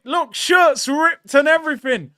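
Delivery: normal talking voice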